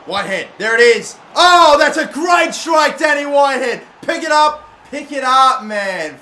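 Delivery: real joy, high and loud, stretched out; excited shout. Real, not acted. Gamer celebrating his striker's goal, excited shout. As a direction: roar the goal like a fan.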